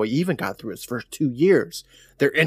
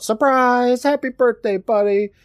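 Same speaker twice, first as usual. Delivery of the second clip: mock yell; 11 semitones higher, 4 dB louder than usual. Carl springing a birthday prank on his brother, mock yell. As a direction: call it out high and a bit louder, mock-celebratory. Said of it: "Then Carl yells out".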